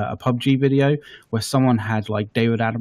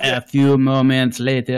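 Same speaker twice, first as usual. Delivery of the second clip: nature documentary; 4 dB louder, slower than usual. Acted. Documentary narrator cut line.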